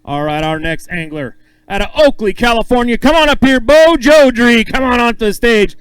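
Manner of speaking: loud, stretched-out announcer intro; big stage emcee calling the next angler up. Acted. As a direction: boom the name out like a ring announcer.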